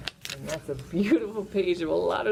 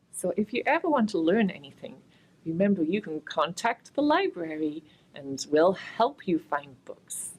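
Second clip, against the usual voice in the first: normal talking voice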